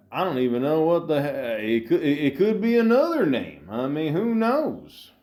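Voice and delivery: hammed-up high voice, slowing into a long drawn finish; put-on exaggerated voice. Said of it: "I'm gonna stop talking like this".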